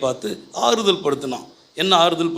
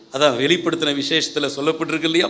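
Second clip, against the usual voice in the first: ordinary talk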